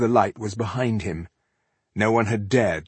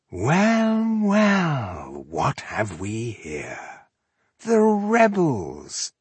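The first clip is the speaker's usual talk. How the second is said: drawn-out, swooping elf villain; velvety; 5 semitones higher, much slower than usual. A narrator's reading. Elf greeting the rebels he has caught, velvety. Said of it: "said the Alf in a velvety voice".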